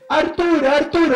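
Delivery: loud, high call of a name. Retold or imitated. A house guest calling the boy's name loudly.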